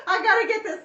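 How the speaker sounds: high nervous laugh into loud words; laugh-cry, desperate and loud; half laughing, half crying with nerves. Real, not acted.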